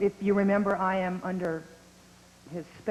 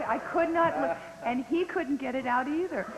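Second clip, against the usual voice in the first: usual speaking voice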